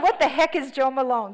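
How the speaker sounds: loud, high, baffled mock question; mock bafflement. Texting her sister about the unknown perfume, loud mock bafflement. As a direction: ham up total confusion.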